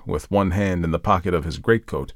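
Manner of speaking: plain reading voice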